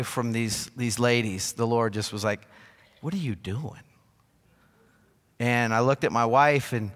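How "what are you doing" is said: softly voiced reproving question; quiet rebuke. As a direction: check someone gently, in a hushed voice. Voices the Lord's gentle rebuke.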